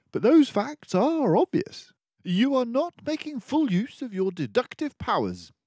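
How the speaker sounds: a composed mouse detective taking charge; Basil the detective. Acted. Basil lectures Dawson.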